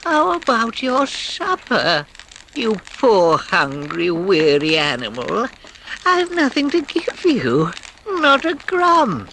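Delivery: high, slow, moaning little voice; moaning Mole. A narrator's reading. Mole to the weary Rat, a high slow moan. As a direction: Mole pities his hungry friend, mournful and high. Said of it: "he moaned".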